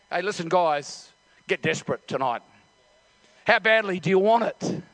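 ordinary delivery

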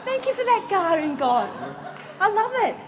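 high, joyful, laughing thanks to God; delighted thanks. Acted. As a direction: gush with childlike delight at the garden. Acting joyful Adam in garden.

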